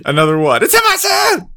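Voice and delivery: loud, high-pitched hollering; mock passenger shout. Host re-enacts the yelling plane passengers, high mock shout. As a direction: holler it out, climbing to a high yell.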